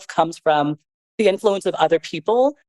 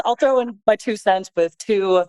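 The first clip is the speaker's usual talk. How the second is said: usual speaking voice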